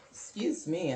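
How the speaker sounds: ordinary talk, nothing marked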